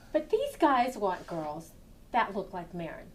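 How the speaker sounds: ordinary talk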